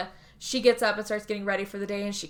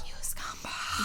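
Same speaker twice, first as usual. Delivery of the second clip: slow, voiceless mock-disgusted whisper; whispered contempt; 6 dB quieter, slower than usual. Viewer cursing at the cheating pair, slow contemptuous whisper. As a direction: draw the insult out in a whisper.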